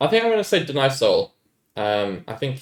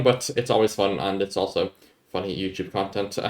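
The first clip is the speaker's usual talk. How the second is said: ordinary talk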